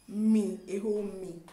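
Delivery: loud, punched outburst of disbelief; indignant disbelief. Retold or imitated. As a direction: exclaim it loudly, offended at being conned. Retold disbelief at being conned.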